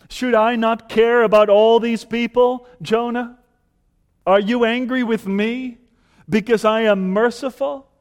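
loud, high pleading; God pleads with Jonah; pleading question. Acted. God asks Jonah, loud and high pleading. God's closing question, intensified.

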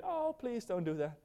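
mild plea. Acted. Preacher acting a too-gentle parent to a child, mild plea. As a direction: plead softly, far too mildly to stop anyone. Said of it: "what do you do? Do you get".

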